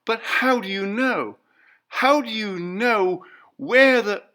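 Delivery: high, loud, urgent questions; wife desperate to understand; frantic. A narrator's reading. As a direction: press him in panic. Clarice voiced high.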